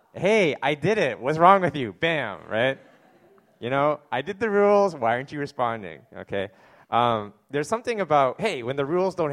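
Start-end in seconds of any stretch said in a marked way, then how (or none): 0.1-1.9 higher, louder mock outrage at the other kids; hammed-up toddler demanding an answer; indignant toddler. Pastor acting the baby frustrated at daycare kids who ignore him, higher and louder. 4.1-6.1 higher, louder mock outrage at the other kids; hammed-up toddler demanding an answer; indignant toddler. Pastor acting the baby frustrated at daycare kids who ignore him, higher and louder.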